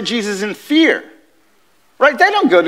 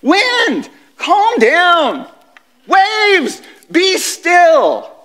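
commanding Jesus; 8 semitones higher, much slower than usual. Acted. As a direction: order the storm to stop. He speaks.

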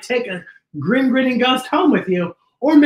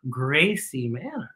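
ordinary delivery

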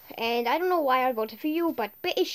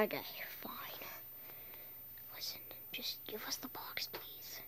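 whisper; 4 semitones lower, 22 dB quieter than usual. Real, not acted. Hushed plea during game.

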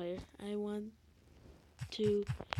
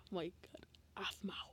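whisper; 3 semitones lower, 11 dB quieter than usual. Real, not acted. Whispering while playing.

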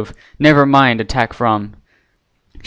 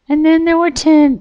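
very high, eerie pony voice, not truly whispered; lightly creepy singsong. A narrator's reading. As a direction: Pinkie taunting the guards, creepy. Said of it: "Pinkie whispered in a creepy voice".

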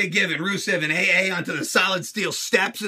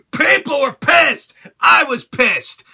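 emphatic anger; 3 semitones higher, 6 dB louder, slower than usual. Real, not acted. Angry rant about finish.

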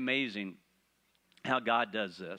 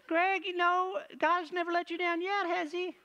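much higher mock wife voice; imitating wife. Wife calling Greg, high mock imitation. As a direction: pitch it way up like his wife. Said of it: "what's my wife say?".

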